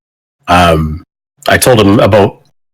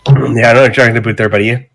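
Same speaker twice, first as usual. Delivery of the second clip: put-on Canadian accent; much faster than usual. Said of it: "that should be the new Canadian accent".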